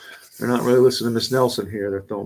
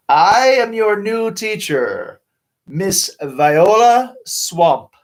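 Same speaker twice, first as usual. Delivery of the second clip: stern witchy teacher; 7 semitones higher, 5 dB louder, much slower than usual. Acted. Witchy new teacher introduces herself.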